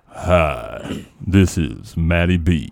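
low, slow seductive voice; sexy voice. Pretend. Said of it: "yours is definitely different".

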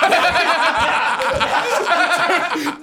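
high, loud helpless laugh; burst of laughter. Real, not acted. Hearing the bouncer's demand again, bursting out laughing. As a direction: crack up completely. Friends react, big laugh.

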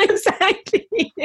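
high, drawn out through real laughter; laughing agreement. Real, not acted. Runner agrees with her friend's name joke, real laughter. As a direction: agree while cracking up.

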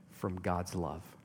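slow, soft whisper of "from God's love"; an intimate whispered assurance. Real, not acted. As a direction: let it sink to a whisper. Sermon hushes to whisper.

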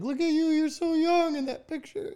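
high cooing voice over a photo; high-pitched gushing. Retold or imitated. Teasing a friend about an old picture, high gushing. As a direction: coo it way up high.

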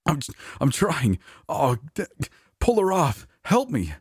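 high, strained and stammering; panicked plea. Acted. Coworker begging the others to pull Leia off, panicked. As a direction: beg for help in a panic.

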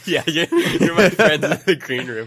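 talking through laughter; laughing. Real, not acted. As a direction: laugh while saying it. Laughing at the bit.

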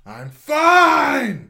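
high, loud, exasperated yell; a high, loud over-the-top snap; exclamation-mark shout. Pretend. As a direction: shout it like five exclamation marks. Reading in all the exclamation marks.

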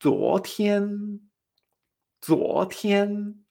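"zuotian" rising to a held high note; repeat-after-me "zuotian", drawn out; tones two-one. Shown as a demonstration. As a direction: climb on "zuo", keep "tian" high and level. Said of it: "utiliza esta misma entonación".

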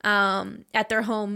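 ordinary talk

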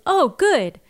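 light up with acted-out delight; happy relief. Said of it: "she's obviously happy".

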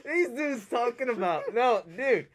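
can barely get it out for laughing; laughing in disbelief. Real, not acted. Fan cracking up over the sprinkler ending, laughing hard.

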